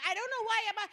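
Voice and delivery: high, loud flustered imitation; flustered young man. Speaker imitating the flustered petitioner, high and loud. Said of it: "he was so flustered, he goes".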